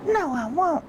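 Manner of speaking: high, loud falsetto "No, I won't"; lady refusing. Retold or imitated. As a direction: squeal the refusal like a prim lady. Voicing the lady refusing again.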